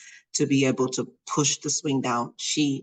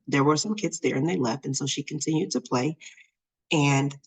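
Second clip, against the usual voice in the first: ordinary delivery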